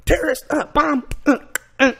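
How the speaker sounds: loud, high involuntary blurts; imitated tic outburst. Man going through an airport, imitated tic shouts. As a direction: blurt each word as an uncontrollable tic. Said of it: "He can't. It's like whatever".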